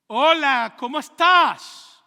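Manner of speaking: hola gritado muy alto y alargado; gritando hola. Retold or imitated. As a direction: grita el saludo para que lo oiga. Tercer saludo, gritando. (grito lento y muy fuerte)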